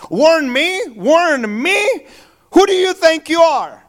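a hint of an offended thief bristling; indignant burglar.

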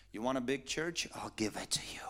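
secretive tempter at the ear; whisper in the ear. Retold or imitated. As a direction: murmur it softly, then fully whisper the promise. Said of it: "I heard a whisper in my ear".

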